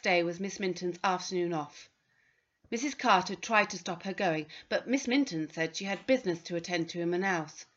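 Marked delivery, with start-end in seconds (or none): none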